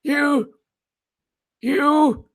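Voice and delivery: put-on very high, slow old senator voice; Senator Leahy. A mock of a senator sputtering at a hearing, high and slow. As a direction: squeak it out like a doddering senator. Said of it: "I'm Senator Leahy".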